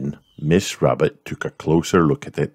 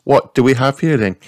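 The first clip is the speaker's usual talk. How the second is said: high, louder cheerful mum voice; Mummy Pig greeting; 9 semitones higher, 6 dB louder than usual. A narrator's reading. Mummy Pig greets George, high and louder. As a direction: greet the little boy as his mother. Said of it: "said Mummy Pig".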